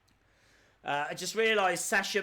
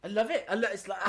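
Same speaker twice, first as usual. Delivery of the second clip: usual speaking voice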